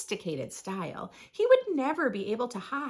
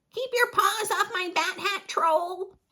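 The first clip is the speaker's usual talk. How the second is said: high, loud protest at a troll; outraged, raised-pitch warning; indignant yell; 9 semitones higher, 4 dB louder than usual. Acted. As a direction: shout it to fend off an attacker. Friend in trouble fends off troll.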